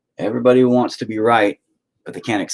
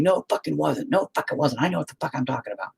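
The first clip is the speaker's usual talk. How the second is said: higher-pitched but softer imitation of a cross wife; mimicked angry wife; 7 semitones higher, 6 dB quieter, faster than usual. Husband retells his wife getting pissed at him, with a higher, softer mock voice. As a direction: hiss her insistence back at the listener, quickening at the end. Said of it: "She was getting pissed at me".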